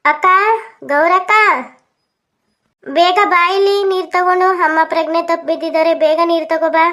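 high, loud call for help; panicked shouting. Acted. Brother calling sister to bring water, panicked shout. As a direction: yell urgently into the next room.

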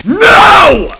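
one long, high, very loud cry; furious scream. Real, not acted. Player refusing to die again, furious scream. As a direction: scream the refusal at full force. Climax of rant.

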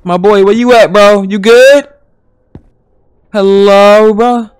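loud, high call across the room; loud searching call. Real, not acted. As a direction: shout for him to show up. Calling out for the boy.

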